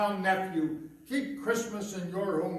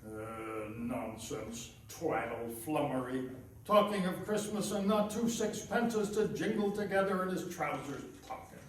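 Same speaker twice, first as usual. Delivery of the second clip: low, much softer grumble to himself; muttered grumbling; 5 semitones lower, 7 dB quieter than usual. Acted. Miser alone after his nephew leaves, grumbling quietly to himself. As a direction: mutter sourly to nobody.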